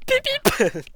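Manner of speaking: high beep-beep mimic; beep noise. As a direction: imitate a car lock beep. Mimics car lock beep.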